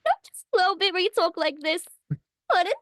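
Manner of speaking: high New York accent Harley; brash New York gangster girl; Harley Quinn voice. Shown as a demonstration. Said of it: "Give us some Harley".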